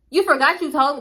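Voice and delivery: usual speaking voice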